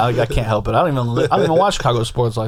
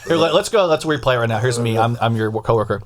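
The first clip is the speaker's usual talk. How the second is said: usual speaking voice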